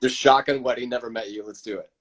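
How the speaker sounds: normal talking voice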